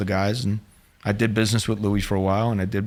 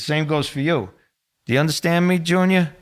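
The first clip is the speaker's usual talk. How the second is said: stern warning; 6 semitones higher, 3 dB louder than usual. Retold or imitated. Boss warning the nephew.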